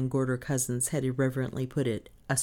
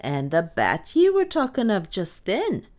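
slow, high, drawn-out sarcasm; mournful satire; 13 semitones higher, 6 dB louder, slower than usual. A narrator's reading. Maid mocking mistress's reassurance, mournfully sarcastic. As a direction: wail the sarcasm slowly. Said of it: "said Lizzie with mournful satire".

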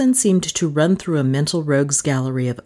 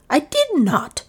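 much higher, indignant repeat; angry; 12 semitones higher than usual. A narrator's reading. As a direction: fling the denial again, rising. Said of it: "said Lizzie angrily".

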